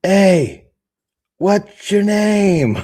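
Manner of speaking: high, loud, slow drawled call; skit voice. Acted. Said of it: "one of the greatest skits ever".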